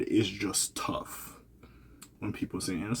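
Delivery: slow, hushed whisper. Real, not acted. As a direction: drop to a whisper. Sensitive point, aside.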